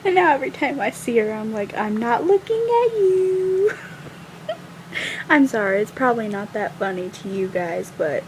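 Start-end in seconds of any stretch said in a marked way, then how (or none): 1.8-3.7 high, drawn-out taunt; teasing singsong. Retold or imitated. Teasing the friend whenever she sees her, high drawn-out taunt. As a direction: tease with a long, high last word.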